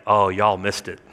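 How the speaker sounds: low, soft aside; Peter bragging. Acted. Peter to those who missed the vision, low teasing brag. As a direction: tease the others under your voice. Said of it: "Peter's gonna be like".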